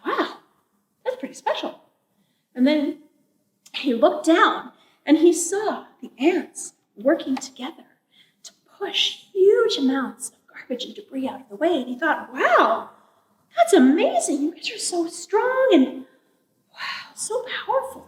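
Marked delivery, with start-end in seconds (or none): 0.1-1.8 high, softened awe; impressed little "Wow"; quiet wonder. A narrator's reading. Raven admires the woodpecker. 12.3-18.1 high, wondering praise; storybook awe, lingering on the praise; amazed admiration. A narrator's reading. Raven marvels at the ants.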